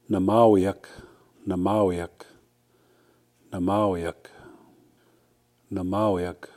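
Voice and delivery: Namawiak with a falling close each time; Cree word Namawiak. Shown as a demonstration. Teacher repeats Namawiak for students, clear falling demo. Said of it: "if you want to say someone".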